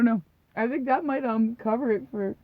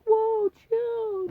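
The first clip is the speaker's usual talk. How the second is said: much higher, wary whoa chill; startled kids; 12 semitones higher than usual. Retold or imitated. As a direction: back off, half-startled. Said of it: "they were all like".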